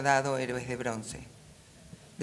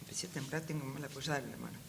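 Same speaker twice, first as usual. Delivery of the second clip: much softer, off-the-cuff aside; quiet aside; 10 dB quieter, faster than usual. Real, not acted. Lecturer breaks off reading over a shaky hand, quiet aside. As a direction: drop the volume for a private remark.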